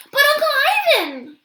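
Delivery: high, loud whiny plea; Ruby pleads with Akello to let her go; pleading child. A narrator's reading. Pleading child. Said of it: "Ruby pleads".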